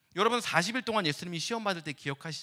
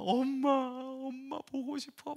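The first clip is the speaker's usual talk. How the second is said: higher, slow whimper for mom; mock-tearful little child; imitating crying child; 8 semitones higher, much slower than usual. Crying son on the ropes calls for mom, mock whimper.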